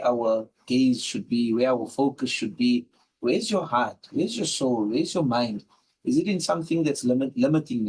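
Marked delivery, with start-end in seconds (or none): none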